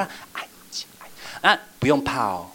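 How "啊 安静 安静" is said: hushed whisper; children whispering. Retold or imitated. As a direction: shush each other quietly. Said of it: "然后小朋友就这样".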